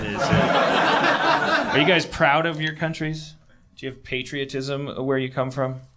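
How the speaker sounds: usual speaking voice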